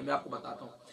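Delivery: usual speaking voice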